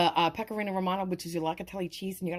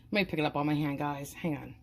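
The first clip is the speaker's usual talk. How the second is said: ordinary talk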